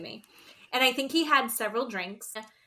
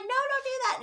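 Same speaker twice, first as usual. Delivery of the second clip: high, giggling no; giggly protest; faster than usual. Retold or imitated. Gino to Jasmine tickling him, high giggly protest. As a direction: squeal it out while being tickled. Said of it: "like, giggling".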